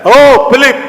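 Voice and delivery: high, loud summons; calling out. Acted. God hailing Philip in the sermon, calling out. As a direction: call him from afar.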